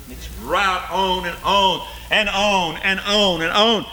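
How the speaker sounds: preaching at full force. Acted. Preacher warning his congregation of hell's torment, full force. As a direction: preach the torment, voice climbing high.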